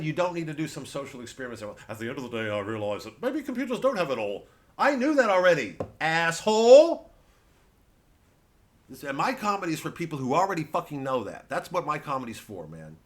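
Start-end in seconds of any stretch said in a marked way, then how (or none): none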